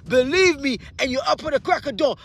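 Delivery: usual speaking voice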